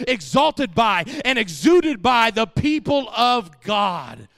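loud, driving sermon cadence; full-force preaching. Acted. Preacher declaring the holy nation's promise, full-force preaching. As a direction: hammer each phrase out to the congregation.

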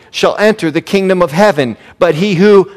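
ordinary talk, nothing marked

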